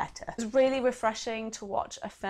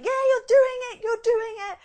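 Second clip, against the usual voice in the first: much higher and louder, cheering someone on; excited cheering; 14 semitones higher, 11 dB louder than usual. Retold or imitated. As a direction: yell encouragement like a fan in the stands. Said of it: "You're like".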